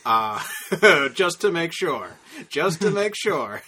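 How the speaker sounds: loud laughing through the words. Real, not acted. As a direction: giggle it out, amused. Laughing over a dark joke.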